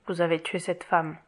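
low, soft, grave statement; quiet accusation. A narrator's reading. Jean Valjean to Javert after Fantine's death, quiet accusation. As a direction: accuse him softly, without raising the voice.

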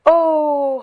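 overjoyed, full-voiced exclamation; rapturous cry. A narrator's reading. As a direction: exclaim in wonder, drawing it out. Fantine rapt, hearing a child.

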